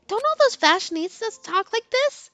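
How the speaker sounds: put-on high, questioning teen girl; high girly voice. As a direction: let it rise in puzzled surprise. Heroine voiced in read story.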